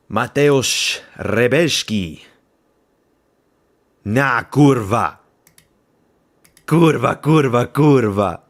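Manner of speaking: loud, drawn-out Polish cheer; put-on Polish accent. Fan cheering on Mateusz Rebecki, loud put-on Polish accent. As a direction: belt it out slowly in a hammed-up Polish accent. Hyping a Polish fighter, swearing in Polish.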